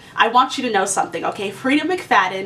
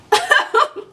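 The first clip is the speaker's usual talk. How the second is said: a squealing giggle, much higher; high burst of laughter; 14 semitones higher than usual. Real, not acted. Reviewer laughing at her own gossip line, high giggle.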